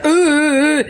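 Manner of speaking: high, loud hooting drone; mock didgeridoo drone. Mum playing a vacuum pipe as a didgeridoo, high and hooting. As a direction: hoot like a bad didgeridoo. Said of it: "I'd go".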